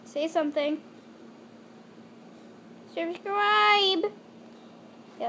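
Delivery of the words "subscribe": higher squeaky pet voice; voicing the pet bunny; animal voice. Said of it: "that's my animal voice".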